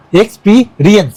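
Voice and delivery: Experience slowly, broken into x, পি, রিয়েন্স; teacher's slow breakdown of experience, part by part; syllable by syllable. Shown as a demonstration. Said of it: "ওয়ার্ডের syllable ধরে ধরে practice করাচ্ছি".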